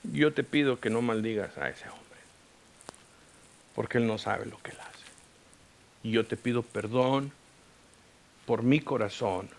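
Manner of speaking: low, soft, humble plea; hushed, subdued petition to God; retold prayer. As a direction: pray quietly, asking forgiveness. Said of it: "me puse en oración".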